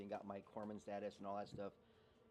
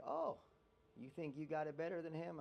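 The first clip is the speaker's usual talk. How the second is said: high, mocking challenge; mocking drill instructor; 6 semitones higher than usual. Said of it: "I think".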